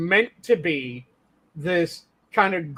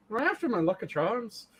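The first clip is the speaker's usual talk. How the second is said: soft mocking leprechaun Irish; stock Cork Irish accent; 3 semitones higher, 7 dB quieter than usual. Complaining everyone does Cork, mock leprechaun Irish accent. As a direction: mimic a clichéd County Cork accent, softly. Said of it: "It's always that".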